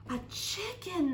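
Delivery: storyteller's slow, high mock shout. A narrator's reading. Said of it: "they all shouted".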